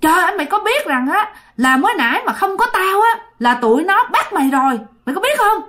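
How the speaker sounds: scolding rant. Retold or imitated. Stranger berates the boy for running away, high ranting scold. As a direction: berate the boy, rising on each question. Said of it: "Mới la ông nội tiếp".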